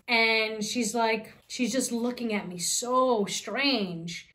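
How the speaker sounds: normal talking voice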